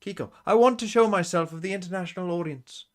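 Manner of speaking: put-on high, affected British accent; Kiko, pansy British. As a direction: ham up a British player, pitched well up. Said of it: "It's pansy British".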